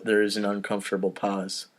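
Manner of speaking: ordinary talk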